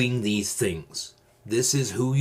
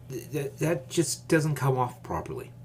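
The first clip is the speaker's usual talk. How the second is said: normal talking voice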